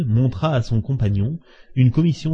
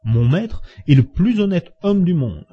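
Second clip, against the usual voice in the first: high, emphatic defence of the master; vehement exclamation; 7 semitones higher, 3 dB louder than usual. A narrator's reading. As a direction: protest hotly, pitch leaping up. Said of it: "s'écria Passepartout en frappant la table". (protestation véhémente, voix montante)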